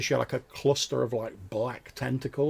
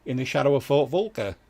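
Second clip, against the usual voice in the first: loud, higher-pitched villager voice; hesitant, helpful local, speaking up; friendly fisherman; 6 semitones higher, 11 dB louder than usual. Acted. Fisherman offering strangers help, loud and friendly.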